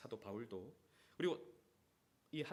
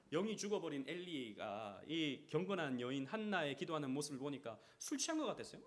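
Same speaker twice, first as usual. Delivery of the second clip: ordinary delivery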